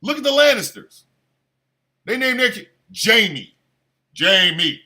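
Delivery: normal talking voice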